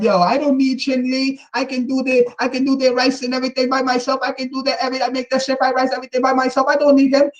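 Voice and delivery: lightly put-on, teasing imitation; mock Chinese woman. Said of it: "Chinese women talking about".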